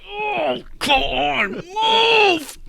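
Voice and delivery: very high, strained wail; hammed-up despair, forcing a frozen body to move; frozen hero begs himself to move, high and overdone; mock anguished cry.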